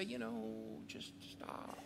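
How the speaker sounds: breathy hush, barely voiced; quiet whisper. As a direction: whisper it softly, almost to yourself. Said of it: "walk into the crowd quietly and say".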